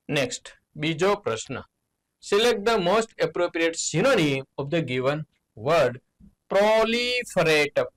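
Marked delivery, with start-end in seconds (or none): 2.3-6.0 slow reading. Shown as a demonstration. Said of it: "teacher reads question slowly". 6.5-7.9 proliferate drawn out high and slow; stretched out word. Shown as a demonstration. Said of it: "teacher reads the word slowly".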